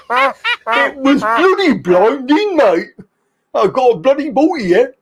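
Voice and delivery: high, laughing mock Brummy; bad Brummy accent. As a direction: defend your terrible Brummy accent in that accent. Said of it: "That's the worst Brummy accent you've ever heard".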